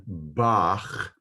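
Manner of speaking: Bach ending in a harsh back-of-the-throat CH, drawn out slowly; slow Bach with a guttural final CH. Shown as a demonstration. Teacher giving an example word, harsh throaty Bach. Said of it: "a very harsh C H sound".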